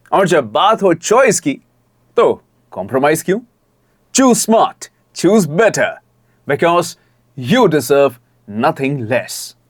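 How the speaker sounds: persuasive tagline with deliberate pauses; expressive demo read. Shown as a demonstration. Coach showing how the ad script can end, expressive. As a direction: build the choice, then land the closing phrase. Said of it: "इस तरह से आप उसको कर सकते हैं".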